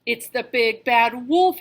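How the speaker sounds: Percy warning his brothers, loud; crying out. A narrator's reading. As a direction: shout the alarm. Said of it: "he cried".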